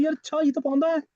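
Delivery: students' imagined aha moment; pretend realisation. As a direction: play the student who suddenly gets it. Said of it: "तो अब हमें समझ आ रहा है".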